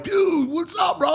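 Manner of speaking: high-pitched, pumped greeting; fired up. Retold or imitated. Running into Bryce Harper at the party, all fired up. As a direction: hail a buddy, high and excited. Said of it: "I'm, like, all fired up".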